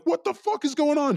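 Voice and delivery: very high, reenacted bewildered cry; high-pitched mock panic; retold alarmed yell. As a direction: act out being startled at gunpoint. Startled at gunpoint.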